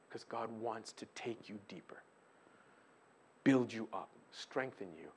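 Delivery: soft unvoiced whisper. Real, not acted. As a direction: lean in and whisper the promise. Intimate appeal.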